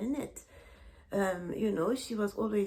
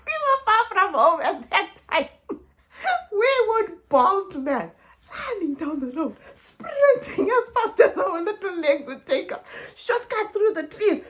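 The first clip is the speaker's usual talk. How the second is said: loud, high, laughing rush; breathless excitement; 10 semitones higher, 10 dB louder than usual. Real, not acted. Kids racing home before five, loud laughing excitement. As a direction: tell it like you're still sprinting home.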